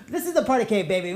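usual speaking voice

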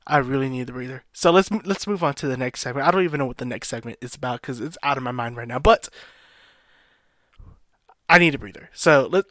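usual speaking voice

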